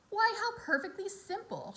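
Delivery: high, musing spider voice; light bright idea said aloud; Charlotte musing. A narrator's reading. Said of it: "she said to herself".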